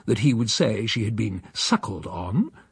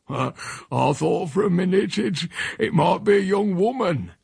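put-on raised, flustered old countryman; old Uncle Silas flustered; 7 semitones higher than usual. Silas to his nephew who walked in unannounced, raised and flustered. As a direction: stammer it out, caught with his trousers off. Silas caught undressing, embarrassed.

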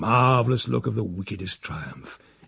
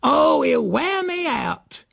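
much higher, slow exasperated housekeeper; despairing cry; 3 dB louder, slower than usual. Acted. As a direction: wail it out, worn down. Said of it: "with the old despairing cry".

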